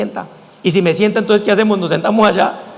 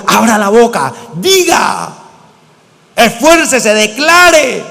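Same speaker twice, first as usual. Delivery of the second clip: shouted exhortation; much slower than usual. Shown as a demonstration. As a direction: shout it like rousing a praying crowd. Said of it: "les digo".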